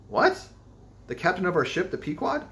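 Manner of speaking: sharp high astonished question; startled disbelief; surprised. A narrator's reading. Shock at captain's name.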